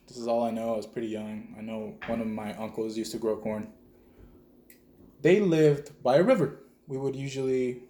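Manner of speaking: usual speaking voice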